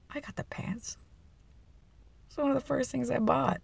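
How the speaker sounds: whispery, high secret; confiding aside. Real, not acted. Telling viewers the pants were bought first, hushed and confiding.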